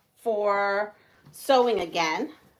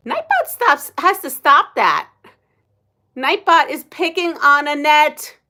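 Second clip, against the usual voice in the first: raised, louder, half-joking scold at the bot; mock scolding; 6 semitones higher, 7 dB louder than usual. Real, not acted. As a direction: tell off the naughty chat bot, playfully.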